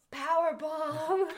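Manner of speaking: high, loud drawn-out announcer yell; big playful holler; mock wrestling shout. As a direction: bellow it like a wrestling move, stretched out.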